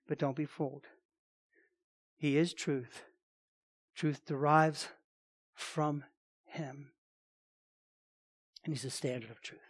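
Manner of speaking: hushed, reverent preaching; quiet solemn preaching. Real, not acted. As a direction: drop the voice low and let each phrase sink in. Hushed close of point.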